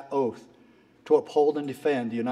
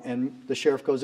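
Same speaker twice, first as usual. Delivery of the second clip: ordinary talk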